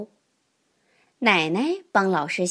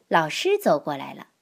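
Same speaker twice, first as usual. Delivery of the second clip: plain reading voice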